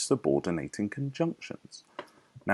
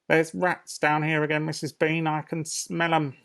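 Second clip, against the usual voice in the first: higher, louder servant voice; maid's voice; 9 semitones higher, 4 dB louder than usual. Acted. Maid calling up to her mistress about rats, higher. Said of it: "repeated quote".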